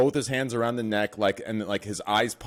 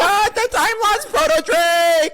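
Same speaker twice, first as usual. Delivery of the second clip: shrieking high-pitched mockery of a gamer; Greek; 9 dB louder, slower than usual. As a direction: squeal it loud in hammed-up excitement. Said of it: "you sound like fucking".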